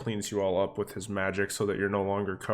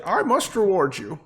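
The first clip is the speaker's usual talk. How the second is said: high falsetto lady, rising higher as she goes; ecstatic woman; 12 semitones higher, 5 dB louder than usual. Acted. Cat's owner offering her rescuer a reward, ecstatic. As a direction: eagerly beckon in a put-on high voice. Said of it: "And she'll say".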